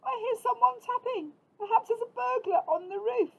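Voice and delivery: high, loud worried mouse voice; a tiny mouse scared of a burglar; frightened little mouse. A narrator's reading. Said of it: "called Little Mouse".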